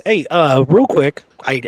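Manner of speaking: ordinary talk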